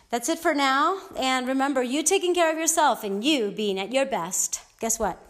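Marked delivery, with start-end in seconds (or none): none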